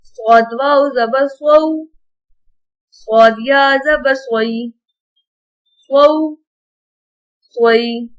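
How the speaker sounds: gentle, even Qaida recital, no stretching or jerking; patient teacher's drill reading. Acted.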